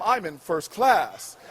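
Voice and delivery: very low, slow, self-important reply; smug. Acted. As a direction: drop deep and savour each word. Smug answer to flight attendant.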